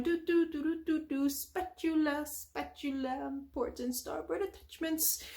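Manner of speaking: high, soft singsong to himself; idly chanting while browsing the aisles; SpongeBob. Retold or imitated. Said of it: "SpongeBob just still roaming up and down".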